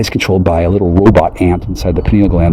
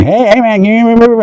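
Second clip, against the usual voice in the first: lightly raised, cartoonish prompting; mock high-voiced nudge; 13 semitones higher than usual. What he's not saying to the soldiers, high mock nudge. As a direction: pester them in a high silly voice. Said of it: "I'm not looking at you and saying".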